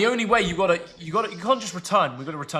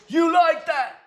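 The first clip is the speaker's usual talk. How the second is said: high, loud quarterback impression; imitating a fired-up quarterback's shout; Kirk Cousins; 13 semitones higher, 6 dB louder, much slower than usual. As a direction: yell the catchphrase way up high. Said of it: "Who goes".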